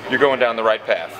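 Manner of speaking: ordinary delivery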